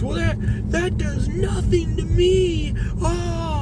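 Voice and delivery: high, slow imitated wail; mock crying. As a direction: mimic a maced man crying while acting tough. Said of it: "crying at this point".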